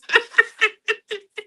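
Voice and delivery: squealing high laugh; can't hold in the laugh; high giggle. Real, not acted. Laughing at sister's joke.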